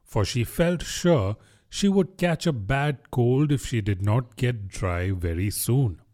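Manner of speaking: ordinary delivery, nothing marked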